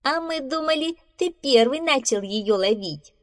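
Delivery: high-pitched, mildly mocking squirrel; teasing. Squirrel smirking at rescued beaver, teasing. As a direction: tease him lightly, starting high. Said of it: "усмехнулась белка".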